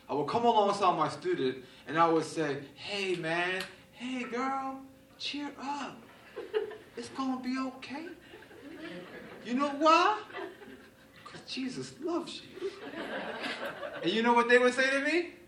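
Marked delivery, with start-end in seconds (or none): 2.7-12.7 higher, softer, pep-talk voice; soft sing-song cheering up; gentle comforting. Retold or imitated. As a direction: coax a sad teen kindly. Pastor comforting students after breakups.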